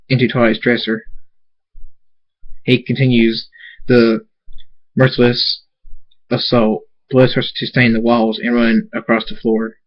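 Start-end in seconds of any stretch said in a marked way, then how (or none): none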